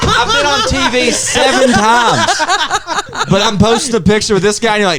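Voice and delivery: high, laughing disbelief; laughing, high-pitched mock outrage; mock indignation. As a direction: play it like a hurt, amused star.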